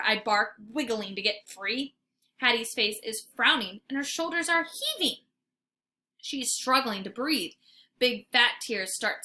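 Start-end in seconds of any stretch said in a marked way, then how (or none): none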